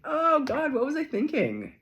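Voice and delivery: high, loud mock regret at yourself; mock dismay. Reader mimics her own regret, high and loud mock dismay. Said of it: "you're like".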